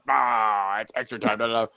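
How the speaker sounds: mock show-intro voice; Weeby. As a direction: toss off a host's catchphrase. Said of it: "he does his".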